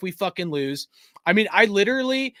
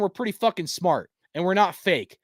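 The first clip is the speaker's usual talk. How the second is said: usual speaking voice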